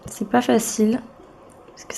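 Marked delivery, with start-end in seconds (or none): 1.7-2.0 whispered aside while concentrating. Real, not acted. Vlogger whispering to herself while working. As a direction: breathe it under your breath.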